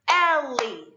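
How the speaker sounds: Ellie chanted loud in two drawn-out beats; syllable by syllable. Shown as a demonstration. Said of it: "Let's clap 'em out".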